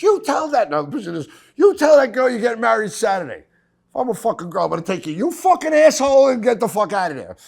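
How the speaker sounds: high, loud screeching woman; mock furious ex-wife. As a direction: scream at him like the betrayed fiancée. Ex-wife yelling at him.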